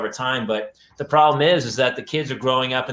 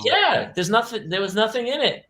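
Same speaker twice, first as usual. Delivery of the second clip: daughter voice; 9 semitones higher than usual. Retold or imitated. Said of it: "And then she goes".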